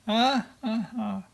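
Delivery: mock laugh.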